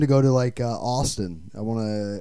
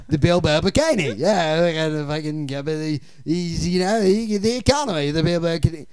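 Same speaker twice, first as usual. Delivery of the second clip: put-on higher-pitched parody of a thick New Zealand accent; exaggerated New Zealand vowels, teasing; mocking New Zealand accent; 4 semitones higher, 3 dB louder than usual. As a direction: mimic a Kiwi failing at an American accent. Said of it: "very thick New Zealand accent".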